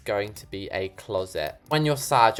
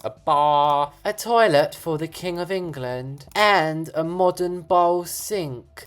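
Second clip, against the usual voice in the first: put-on posh British accent; 5 semitones higher, slower than usual. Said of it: "I kind of wish I taught that posh".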